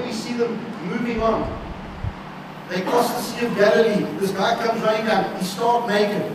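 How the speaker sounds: loud, building sermon climax; preaching at full force. Acted. Preacher thundering the healing stories to his flock, loud and rising. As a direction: drive the story home to the congregation.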